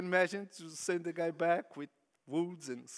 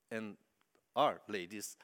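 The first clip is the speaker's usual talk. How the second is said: usual speaking voice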